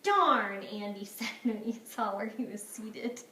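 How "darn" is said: put-on loud, dismayed kid's grumble; exasperated, punched-out exclamation; disappointed outburst. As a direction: blurt it in mock dismay. Boy dismayed at his seat.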